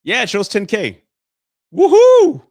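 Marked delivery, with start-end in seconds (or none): 1.7-2.4 big high delighted whoop, much louder; excited cheer. Real, not acted. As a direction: celebrate the milestone out loud. Hitting ten K subscribers.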